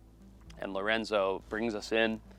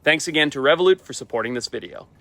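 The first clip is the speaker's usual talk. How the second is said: brisk upbeat ad read, pitched up; sponsor read; 4 semitones higher, 6 dB louder than usual. Acted. Speaker thanks his video's sponsor, upbeat ad read. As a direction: punch it out like a sponsor plug.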